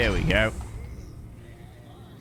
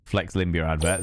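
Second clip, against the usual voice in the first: ordinary delivery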